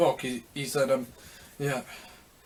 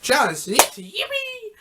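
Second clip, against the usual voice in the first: high, thrilled squeal of joy; excited yelp; 13 semitones higher, slower than usual. Retold or imitated. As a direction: squeal it out, sky-high and delighted. Retold excited shout of idea.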